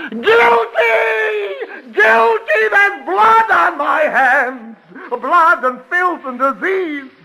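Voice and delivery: hammed-up guilty raving. Prisoner performing for the guards, overwrought shouted confession. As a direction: rave your guilt like a tragic madman. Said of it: "Curtain going up".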